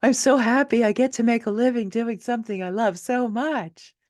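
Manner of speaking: high, bright-eyed gushing thanks; gushing award winner. Retold or imitated. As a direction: gush it like an award acceptance. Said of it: "She said".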